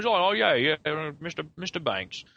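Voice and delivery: lightly imitated chummy chimney sweep; Bert. Film character talking to the camera, lightly imitated. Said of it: "he, like, looks at the camera".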